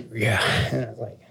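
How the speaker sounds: normal talking voice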